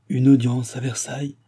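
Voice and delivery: usual speaking voice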